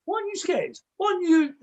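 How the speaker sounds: high, puzzled dog voice; a dog thinking aloud in a high voice; dog's voice. Retold or imitated. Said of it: "it suddenly goes".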